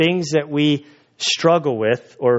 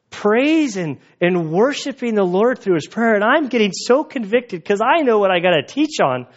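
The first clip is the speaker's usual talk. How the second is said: ordinary talk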